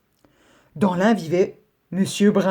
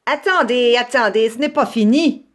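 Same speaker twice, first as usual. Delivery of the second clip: louder, grumbling fox calling after the rabbits; growling fox; 5 semitones higher, 4 dB louder than usual. Acted. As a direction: growl that it isn't over yet. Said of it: "gronda le renard".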